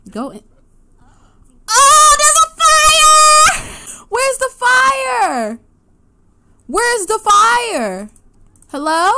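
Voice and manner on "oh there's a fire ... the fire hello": much higher, louder, slow panicked yelling; alarmed shout. Real, not acted. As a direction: yell in panic about the fire. Sim catches fire.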